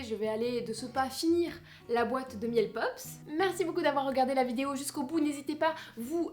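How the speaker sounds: normal talking voice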